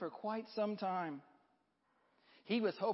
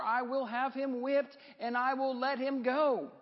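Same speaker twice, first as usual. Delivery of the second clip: lightly acted appeal, pitched up; a touch of exasperated questioning, then firm higher-pitched verdict; Pilate protesting; 7 semitones higher than usual. A narrator's reading. Pilate arguing with the crowd for Jesus, raised-pitch protest.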